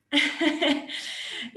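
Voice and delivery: breathy giggle, high; a short unvoiced chuckle; light airy laugh. Real, not acted. Laugh after ironic remark.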